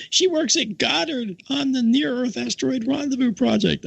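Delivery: high-pitched imitated outburst; retold nun's big reveal. Laughing nun explains her companion's job, high imitated outburst. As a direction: spill the secret in a sustained high voice.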